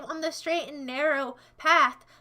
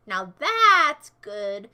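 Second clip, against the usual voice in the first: slow, low, louder soothing voice; deep voice; 5 semitones lower, 8 dB louder, much slower than usual. Pretend. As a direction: play a deep wise father. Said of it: "my deep voice".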